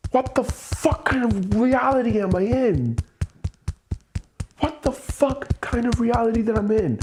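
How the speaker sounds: high, disbelieving outburst; high, furious disbelief; exasperated rant. Real, not acted. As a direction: vent at the world. Ranting about debt and daughter.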